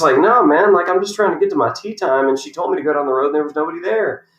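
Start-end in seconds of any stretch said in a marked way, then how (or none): 0.2-2.3 high, loud-starting retold protest; exasperated protest. Player explaining himself to attendant, exasperated. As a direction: plead your case, hammed-up and high.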